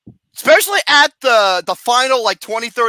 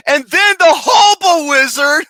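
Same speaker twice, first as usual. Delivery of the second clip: laughing announcement; 5 semitones higher, 5 dB louder than usual. Real, not acted. Announcing the next scene.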